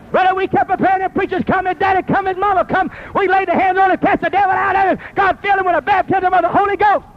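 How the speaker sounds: sermon voice climbing in pitch; preaching. Acted. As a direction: preach it as the story takes off. Continues the fervent sermon.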